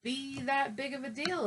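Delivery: plain reading voice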